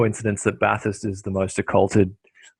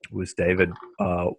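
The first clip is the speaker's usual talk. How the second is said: ordinary delivery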